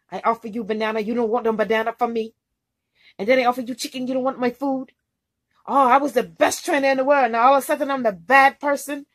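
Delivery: loud mock-offended tirade; imitating ballistic rant. Trainer listing what he offered her, loud imitated outburst. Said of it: "he just went ballistic on me".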